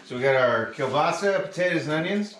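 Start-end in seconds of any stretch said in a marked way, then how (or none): none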